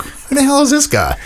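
hammed-up disbelief at the screen; baffled mock outburst. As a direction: act stunned by a stranger's weird accent. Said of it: "You're like".

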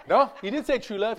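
ordinary talk

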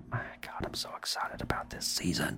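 breathless excited whisper. Real, not acted. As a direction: whisper it like a secret thrill. Excited whisper after warning.

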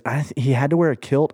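usual speaking voice

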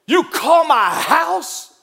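slow, high, loud mock outrage; reenacting rage at the man, drawn-out loud and high; furious challenge. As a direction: spit the question out at the man who called. Retold confronting wife's pursuer.

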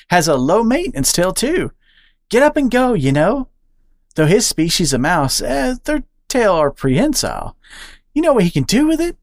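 high, bouncy squirrel voice; excitable squirrel. Acted. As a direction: gossip eagerly to the new friend. Charlie teasing about mate.

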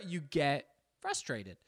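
ordinary talk, nothing marked

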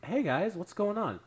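high-pitched fake nonchalance; guilty, too-innocent sing-out; feigned calm. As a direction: act casual, as if nothing was heard. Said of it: "just trying to be calm".

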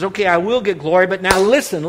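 lightly voiced God, firm and loud; voice of the Lord. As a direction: speak as the Lord, booming. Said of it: "The Lord says".

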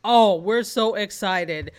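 ordinary delivery

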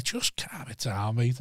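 low, soft and very slow; imitating an unruffled, measured hush; retold calming warning. Boxer settling down the young cabbie, quietly calm.

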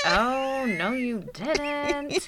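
mock sass to a toddler's gasp; sassy comeback. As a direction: play it like a sassy friend clapping back. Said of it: "and I'm like".

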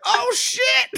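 high shocked yelp; shocked gasp. Retold or imitated. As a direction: squeal it like a stunned audience. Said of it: "we're like".

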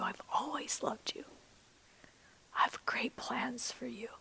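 soft breathy guided-prayer hush; hushed prayer whisper. Acted. Pastor guiding the congregation to picture God, whispering. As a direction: whisper it gently, like a secret. Whispered imagery, Father's words.

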